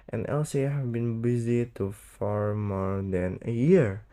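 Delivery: plain reading voice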